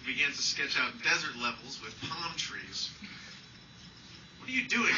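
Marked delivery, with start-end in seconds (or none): 4.4-4.9 high, bright-toned mom question; mom's voice. Retold or imitated. Mom poking into her son's room, high and questioning. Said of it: "Mom would ask".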